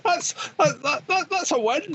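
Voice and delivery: high, laughing shout of delight; giddy laughing win; laughing triumph. Real, not acted. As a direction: crow the win through laughter. Laughing triumph over the call.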